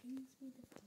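ordinary delivery, nothing marked

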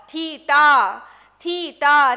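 تھیٹا said slowly and loud, aspirated t, falling tune; clear theta pronunciation. Shown as a demonstration. Teacher models تھیٹا loudly so pupils can copy. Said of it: "کیسا تلفظ کریں گے اردو میں".

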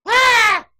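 loud, high yelp of a scream; mock scream. Shown as a demonstration. Host showing how she screamed at the movie, mock scream. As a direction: let out a short mock shriek. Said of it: "So what was I doing?".

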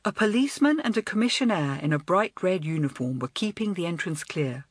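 ordinary delivery

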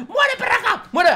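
loud, high comic cry; mock yell. Real, not acted. Gamer yelling at patient by mistake. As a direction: bark it out in jest. Yells wrong word at patient.

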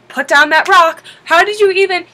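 loud stern command; scolding teacher. Retold or imitated. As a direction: bark it at a kid across the park. Teacher scolding kid with rock.

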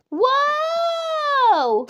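high, loud startled whoa; a sudden startled cry; surprised shout. A narrator's reading. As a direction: shout it as she falls. Crowd reacts to a fall.

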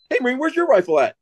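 high, quick demand; mock challenge. Staff sergeant confronting another fainted Marine, high mock challenge.

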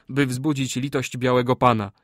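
ordinary delivery, nothing marked

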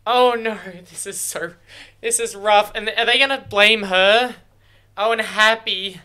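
real distress spilling out; loud anguished reaction to a death scene; upset. Real, not acted.

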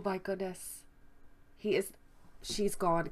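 ordinary talk

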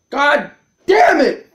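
high, loud swearing at yourself; angry curse. Real, not acted. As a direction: shout it in real exasperation. Player curses own mistake.

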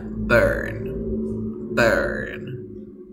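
"burn" drawn out with an E-type vowel. Shown as a demonstration. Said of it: "se pronuncian con la misma vocal".

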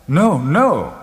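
emphatic, insistent denial; exclaiming. A narrator's reading. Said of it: "I exclaimed".

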